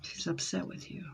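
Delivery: whispered aside to the client; soft whispered reveal. Real, not acted. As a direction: breathe it out confidentially. Reader relaying spirit message.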